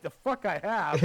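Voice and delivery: slow, pressed angry denial; imitating his indignant retort. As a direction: bite off each word in disbelief. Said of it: "I am literally pissed".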